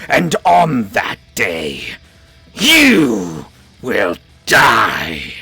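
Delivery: deep, slow lich voice; menacing undead king; ancient rasping undead king. Acted. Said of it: "says the Lich".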